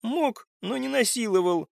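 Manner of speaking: high, pitiful little voice; pitiful squeak. A narrator's reading. Frightened woman timidly contradicts, pitiful squeak. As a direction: squeak it out piteously. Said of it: "жалко пискнула Инга".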